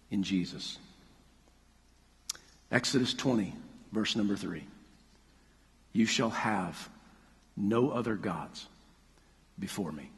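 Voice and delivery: soft, low, intimate preaching; quiet closing prayer. Acted. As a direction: bring it down to a hushed prayer.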